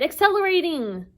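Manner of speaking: high, loud announcement; crying out. Acted. Tempest speeding up the taxi, high cry. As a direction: cry it out as the car surges. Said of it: "Tempest cried".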